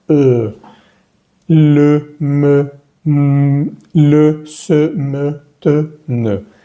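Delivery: rounded "eu" in E, le, me, se, te, ne; steady, held syllables le, me, te, ne; French schwa drill. Shown as a demonstration. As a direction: chant le, me, se, te, ne slowly with rounded lips. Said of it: "tiene que sonar".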